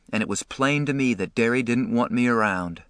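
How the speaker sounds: plain reading voice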